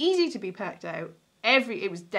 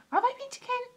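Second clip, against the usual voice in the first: sudden much higher question; surprised self-doubt; faster than usual. Real, not acted. Answering a viewer about Kent, suddenly surprised. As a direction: catch yourself mid-sentence, puzzled.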